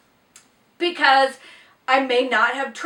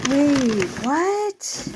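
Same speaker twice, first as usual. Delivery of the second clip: surprised; 3 semitones higher than usual. Real, not acted. Unboxing a surprise gift.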